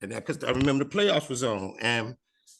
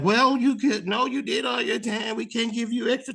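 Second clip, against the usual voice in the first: high, louder mock host voice, firm and bossy; host cutting him off; 10 semitones higher, 3 dB louder than usual. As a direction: play the host laying down the law onstage. Host tells him time's up.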